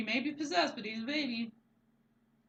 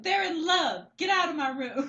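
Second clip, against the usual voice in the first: put-on high, loud bratty kid voice; bratty little girl; 9 semitones higher, 9 dB louder than usual. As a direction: yell it like an annoyed little sister. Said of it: "and she's like".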